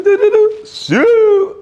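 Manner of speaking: hammed-up action sounds ending in a celebration shout; mock stunt noises. Mimics Ronaldo car stunt.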